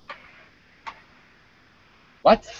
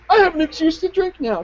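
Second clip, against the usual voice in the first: much higher, mock dumb partier voice; mocking knucklehead; 12 semitones higher than usual. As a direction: sneer at the holiday drunk in a silly high voice. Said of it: "every knucklehead's like".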